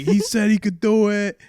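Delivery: loud, high imitation of a Philly guy; heated argument. Philly friend going at the New York doctor, loud mock arguing. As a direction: shout it as a mock protest. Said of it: "they're going at it".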